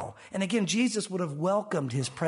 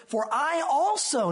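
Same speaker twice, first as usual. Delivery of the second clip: "also" drawn out and higher; stress on ALSO; 5 semitones higher, slower than usual. Shown as a demonstration. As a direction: lean slowly on the word "also". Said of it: "note that word".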